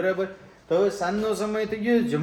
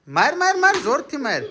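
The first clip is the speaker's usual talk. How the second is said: high, eager repeated "hit"; excited urging; 8 semitones higher than usual. Real, not acted. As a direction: egg her on. Urging girl to hit back. (ઉત્સાહથી ઉશ્કેરે છે)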